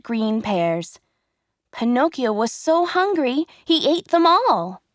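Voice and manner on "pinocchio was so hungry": ordinary delivery, nothing marked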